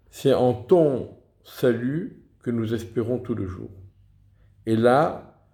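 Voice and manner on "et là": ordinary delivery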